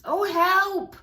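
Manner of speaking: high, loud panicked goose cry; silly goose shrieking help. A narrator's reading. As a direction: squawk for help like a frightened goose. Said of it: "she cried".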